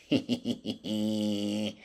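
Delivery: long hee-hee giggle; gleeful giggle. Real, not acted. Cook gloating to viewers over his dish, gleeful giggle. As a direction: giggle with delight, stretched out.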